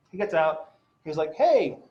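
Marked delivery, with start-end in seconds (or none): 1.3-1.8 higher, friendly greeting, imitating a cop; friendly officer. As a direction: greet like an affable patrol officer. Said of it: "He was a really friendly officer".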